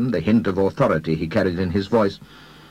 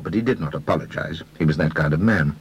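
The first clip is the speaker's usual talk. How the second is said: usual speaking voice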